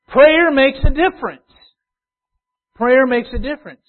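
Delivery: loud, high, urgent preaching; fiery preaching. Acted. Preacher telling the church prayer works, loud preaching. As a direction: press it on the listeners. Preacher exhorting congregation, raised.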